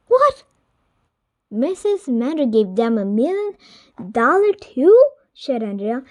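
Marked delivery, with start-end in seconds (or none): 0.1-5.0 outraged disbelief. Acted. Andrea reacts to the donation news, outraged. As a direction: cry out that it's not fair. Kids outraged at rival school.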